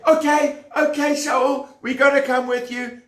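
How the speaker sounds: high, quick, nervous agreement; eager agreeing. Acted. People answering King Shaul, high and hurried. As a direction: rush to say yes.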